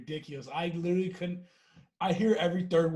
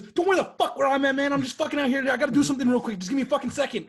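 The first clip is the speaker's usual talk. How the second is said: higher, louder brushing-off shout; mock yell at rabbi; 7 semitones higher, 5 dB louder, faster than usual. Kid yelling at his rabbi on the phone, loud high mock shout.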